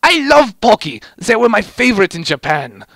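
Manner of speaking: high, loud eager gushing; excited gush. A narrator's reading. Medic gushing to Heavy about Pocky, high loud excitement. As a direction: gush like a giddy fan.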